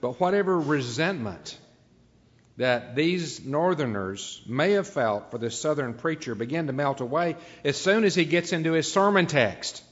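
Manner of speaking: normal talking voice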